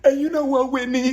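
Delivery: high, loud parting shot; mock going off. Woman storming off from Whitney, mock outraged parting shot. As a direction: throw it back high and loud, hammed-up. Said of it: "Lisa is just going off".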